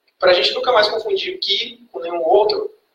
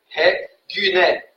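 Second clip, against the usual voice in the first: sounding it out; 2 semitones lower, much slower than usual. Shown as a demonstration. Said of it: "Como antônimo de".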